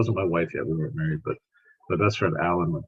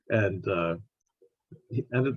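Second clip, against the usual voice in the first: usual speaking voice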